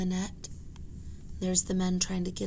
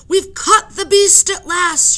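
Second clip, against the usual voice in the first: high, loud, slow cry; triumphant shout; 11 semitones higher, 13 dB louder, slower than usual. Acted. Joe shouts to the crowd that the beast is caught, loud. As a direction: crow it out in triumph. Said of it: "shouted Joe".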